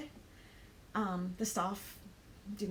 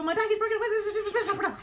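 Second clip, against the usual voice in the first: high, loud, slow panicked wail with a laugh; frantic blurt; 11 semitones higher, 7 dB louder, slower than usual. Retold or imitated. Traveller blurting to the airport lady about her broken bag, frantic high wail. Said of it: "word vomited in Australian all over her".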